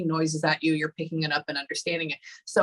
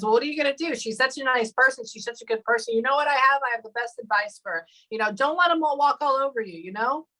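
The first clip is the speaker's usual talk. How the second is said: higher-pitched, lightly imitated Pennsylvania accent; 7 semitones higher than usual. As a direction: channel his voice with a hint of Pennsylvania accent. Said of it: "has a Pennsylvania accent in my head".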